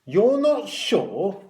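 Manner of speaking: high, loud, drawn-out echo with rising end; loud incredulous question. A narrator's reading. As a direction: repeat it for the whole ward to hear. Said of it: "said Matron loudly so everyone could hear".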